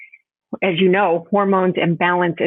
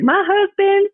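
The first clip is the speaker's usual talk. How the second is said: put-on Southern accent; 13 semitones higher, 3 dB louder, slower than usual. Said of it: "She has this Southern accent".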